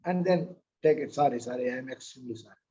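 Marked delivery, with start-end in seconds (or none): 1.2-2.6 quick, low, offhand sorry, sorry; mock apology. Wife mock-apologizing to husband after the unsweetened coffee, quick and offhand. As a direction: rattle off the apology, not meaning it.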